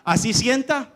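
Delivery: normal talking voice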